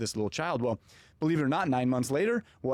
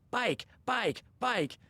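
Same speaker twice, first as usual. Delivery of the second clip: put-on cheering toddler; 10 semitones higher, much slower than usual. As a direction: imitate an excited child chanting from the back seat. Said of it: "he's cheering everything on".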